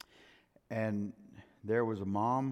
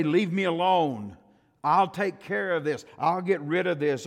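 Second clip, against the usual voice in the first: mock defiant shout, higher and louder; 7 semitones higher, 9 dB louder than usual. Sinner shouting at God to leave him alone, in a mock defiant shout. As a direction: shake a fist at God and shout it.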